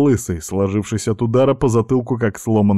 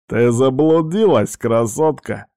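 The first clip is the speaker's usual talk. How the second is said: a leering, raised-voice taunt; smirking; 4 semitones higher, 3 dB louder than usual. A narrator's reading. Bald thug cornering a girl in a dark alley, smirking. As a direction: tease her with a predatory smirk. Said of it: "ухмыльнулся лысый мужик".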